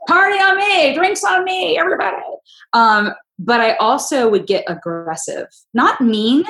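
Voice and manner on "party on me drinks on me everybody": partying shout. Retold or imitated.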